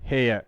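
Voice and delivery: हेयर said as "heya", one open vowel glide; model pronunciation. Shown as a demonstration. Teacher models हेयर for the class, as "heya". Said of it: "ऐसे पढ़ा जाता है इसको".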